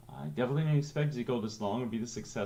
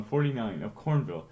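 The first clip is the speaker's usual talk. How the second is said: usual speaking voice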